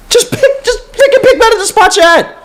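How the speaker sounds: very high, cracking half-laugh, half-whine; exasperated laugh-cry. Real, not acted. Fan begging the front office, laughing in despair. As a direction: break into a pained laugh on "pick".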